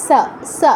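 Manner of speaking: সা with an English s, tongue at the teeth; dental sa. Shown as a demonstration. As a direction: hiss the s in সা, no sh. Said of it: "উচ্চারণটাও তাই".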